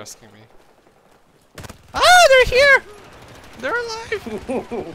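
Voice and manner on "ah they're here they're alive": high loud alarmed yell; panicked shout. Real, not acted. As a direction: scream the warning out. Enemies return in firefight.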